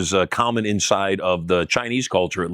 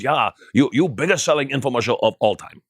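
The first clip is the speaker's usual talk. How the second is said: higher mock Taiwanese accent in broken English; a rough Taiwanese accent, pitched up; imitating Taiwanese accent; 3 semitones higher than usual. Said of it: "That's just kind of the accent that he did".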